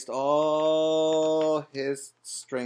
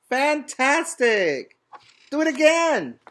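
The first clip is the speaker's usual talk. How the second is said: excited shout; 11 semitones higher, 6 dB louder than usual. A narrator's reading. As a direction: egg him on at the top of your voice. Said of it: "but Elizabeth shouted".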